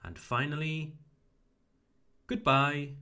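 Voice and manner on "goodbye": "goodbye" with short vowel in good. Shown as a demonstration. Teacher models the last greeting, short oo in "goodbye". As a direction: say "goodbye" with a quick English "good". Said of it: "make it shorter".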